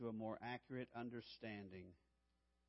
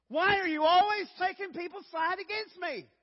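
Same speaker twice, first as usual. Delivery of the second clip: much higher and louder indignant snap; mock-indignant husband yelling at his wife; defensive outburst; 16 dB louder than usual. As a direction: lash out at the wife, wounded. Pastor acting himself snapping at wife.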